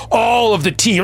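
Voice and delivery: normal talking voice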